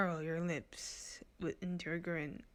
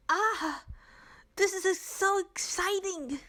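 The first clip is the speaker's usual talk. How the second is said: gushing excitement; 12 semitones higher, 6 dB louder than usual. Acted. Renza gushing.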